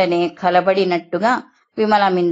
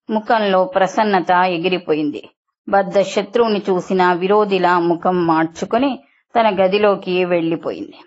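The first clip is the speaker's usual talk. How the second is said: plain reading voice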